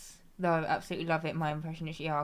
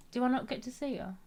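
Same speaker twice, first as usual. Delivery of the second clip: ordinary talk, nothing marked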